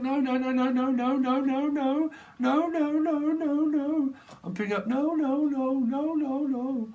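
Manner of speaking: very high yelped no-no-no; squealing animal. Pretend. Animal spirit in the reading squealing no, very high. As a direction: yap like a panicking creature.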